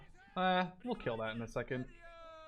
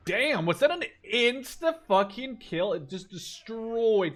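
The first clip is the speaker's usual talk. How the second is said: louder, higher, slow stunned exclamation; genuinely blown away by the hit; amazed; 7 semitones higher, 6 dB louder, slower than usual. Real, not acted. Sudden kill in game.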